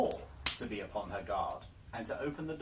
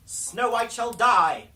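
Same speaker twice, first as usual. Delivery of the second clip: much higher and much louder, slow furious cry; 10 semitones higher, 15 dB louder, slower than usual. Acted. The Queen in a rage at the mirror, furious shout. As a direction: scream the death sentence in rage. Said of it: "she cried".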